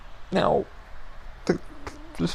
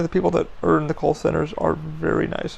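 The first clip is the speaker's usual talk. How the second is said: ordinary talk, nothing marked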